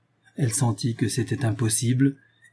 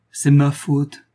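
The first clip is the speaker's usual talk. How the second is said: raised, pained self-blame; tearful rage and grief; 4 semitones higher, 5 dB louder, much slower than usual. A narrator's reading. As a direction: blame yourself in grief, louder and higher. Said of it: "dit-il en pleurant de rage et de chagrin".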